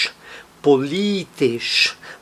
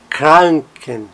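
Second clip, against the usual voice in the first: Kranken with KRAN punched, ken light; stress on KRAN. Shown as a demonstration. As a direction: lean on the first syllable of Kranken. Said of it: "tá no cra". (ênfase na sílaba KRAN de Kranken)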